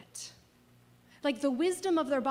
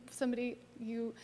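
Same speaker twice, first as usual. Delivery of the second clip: ordinary delivery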